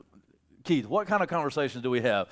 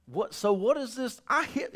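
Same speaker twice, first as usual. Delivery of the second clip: raised, lightly voiced Saul, puzzled; voicing Saul; 6 semitones higher than usual. Preacher voicing Saul, caught with the loot, puzzled. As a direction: play Saul caught out, confused.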